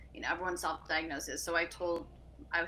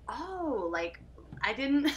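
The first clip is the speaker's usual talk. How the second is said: stunned; 7 semitones higher, much slower than usual. Retold or imitated. Recalling talking to her sister, high flustered surprise. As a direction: caught off guard, fumbling for words. Shocked at sister's ADD remark.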